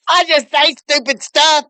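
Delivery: high-pitched mock excuse; mocking quote. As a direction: parody his lame excuse in a high voice.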